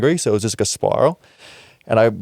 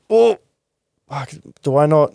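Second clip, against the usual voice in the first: shocked realization; 6 semitones higher than usual. Retold or imitated. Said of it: "instantly, I was like".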